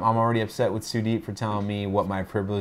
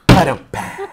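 rimshot noise; 10 dB louder, slower than usual. Acted. Rimshot after the joke.

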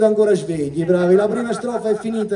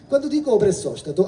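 ordinary talk

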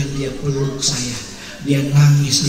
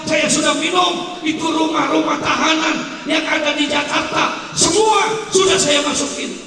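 anguished confession; 13 semitones higher than usual. Acted. Young ex-convict confessing to the preacher, high anguished cry. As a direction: pour out every sin at the top of your voice.

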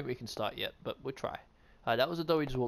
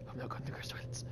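hushed unvoiced whisper; 12 dB quieter, faster than usual. Real, not acted. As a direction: whisper it to the viewers. Whispered aside.

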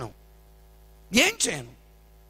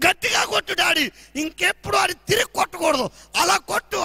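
put-on higher, shrieking child urging harder; eager egging on; 11 semitones higher, 6 dB louder than usual. Daughter eagerly urging daddy to hit harder, much higher.

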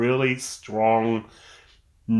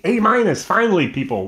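excited cheer; 9 semitones higher than usual. Real, not acted. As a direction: celebrate the grade. Cheering his own grade.

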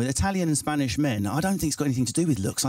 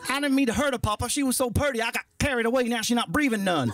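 high-pitched rural American confession; simple farm son; 7 semitones higher than usual. Acted. Farm boy confesses to his papa, high and simple. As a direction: play the guileless farmhand pleading.

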